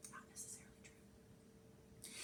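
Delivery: soft unvoiced aside; whispered aside. A narrator's reading. Narrator whispers a correction to the listener, unvoiced. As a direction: breathe the correction like a secret.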